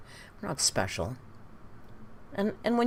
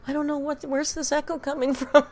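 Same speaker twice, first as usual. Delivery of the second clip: mock confused high voice; 9 semitones higher than usual. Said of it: "the poor thing's going".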